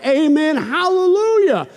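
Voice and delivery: high, exultant cry, stretched at the end; cried-out praise. Acted. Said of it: "And they cried".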